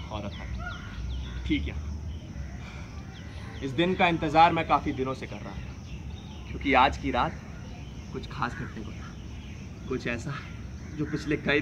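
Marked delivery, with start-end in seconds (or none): 0.1-1.7 breathy unvoiced whisper, slow. Narrator whispering the last room. Whispered aside in tense scene. 8.1-10.3 suspense hush. Acted. Suspenseful build in narration.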